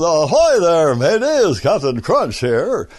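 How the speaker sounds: mumbled sailor voice, big upper lip; barely articulate, lips stiff; Captain Crunch. Retold or imitated. Demoing the cereal captain's stiff-lipped mumble. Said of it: "if you talk like this".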